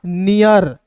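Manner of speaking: नियर drawn out slowly as "ni-yar"; sounded-out pronunciation. Shown as a demonstration. Teacher showing learners how NEAR is read, sounded-out pronunciation. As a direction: say नियर slowly, with an "iyar" ending. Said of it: "ईयर के रूप में करेंगे".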